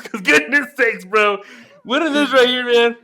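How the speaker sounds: loud, high, slow hype; hyped up. Real, not acted. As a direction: pump up the crowd about the win. Bragging about beer pong win.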